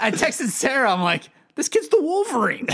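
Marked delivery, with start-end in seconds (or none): 1.6-2.7 high, loud marvelling; amazed proud dad; excited. Retold or imitated. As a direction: announce it like a superhero reveal. Texting wife about healing.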